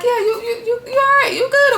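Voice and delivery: squeaky high comforting voice; high mock voice. Portia asking Dennis if he is okay, squeaky high. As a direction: voice her way up high, mock sweet. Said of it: "she's like".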